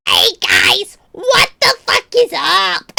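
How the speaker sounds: exaggerated goblin-like streamer greeting, slow and strained; mocking own voice. Streamer imitating their recorded voice for chat, exaggerated screechy self-parody. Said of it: "I sound like I'm like".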